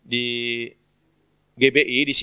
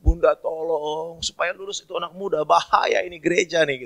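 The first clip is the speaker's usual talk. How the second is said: higher, acted anxious prayer; pleading priest; 5 semitones higher than usual. Priest praying to Mary for the youth, acted plea. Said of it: "pastor bantu doa loh".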